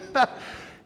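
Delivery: high breathy burst of laughter; airy amused laugh. Real, not acted. Laughing at sponsor's story.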